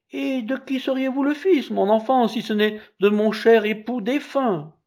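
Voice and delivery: very high woman's voice; high-pitched motherly indignation; indignant mother. A narrator's reading. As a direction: protest in a high, offended voice.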